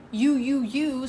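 accusing. Retold or imitated. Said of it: "instead of saying".

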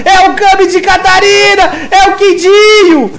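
hyped shouting teamfight call; fighting game announcer. Real, not acted. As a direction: scream the fight like an arena announcer.

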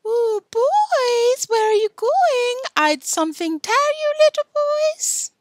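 high, loud mock-girl call; piercing, teasing little-girl voice; shrill, girlish voice. A narrator's reading. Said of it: "calling in a shrill, girlish voice".

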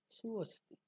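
সুঅস্তি with a high 'suu' falling onto 'osti'; Sanskrit pronunciation. Shown as a demonstration. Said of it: "তাহলে হবে উচ্চারণ". (সুঅস্তি, সংস্কৃত উচ্চারণে)

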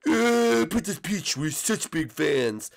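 slow, drawn-out fanboy voice; gushing fan. Acted. Fan greets Princess Peach, drawn-out gushing. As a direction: squeal the start, then drag it out adoringly.

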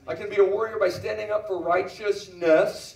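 slow, building proclamation; emphatic preaching. Real, not acted. Preacher declaring how to be a warrior, slow emphatic proclamation. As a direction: stretch and punch the big words.

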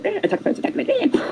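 small high-pitched creature urging others on; escaping dinosaurs. Acted. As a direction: squeak it like little raptors on the attack. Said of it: "They go".

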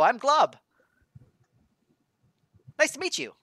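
high, bright-eyed newborn blob; high innocent ooze creature voice; high goofy blob voice. Acted. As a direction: chirp it like a friendly new creature. Glob keeps talking.

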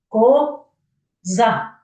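КОЗА as two separate beats, ко... за; counting syllables. Shown as a demonstration. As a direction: break коза apart with a clear gap after ко. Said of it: "Ось скільки ви торкнулися разів".

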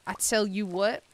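punchy Southern lilt; Southern drawl. Shown as a demonstration. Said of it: "it does come out when I say".

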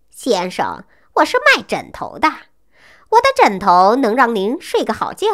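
usual speaking voice

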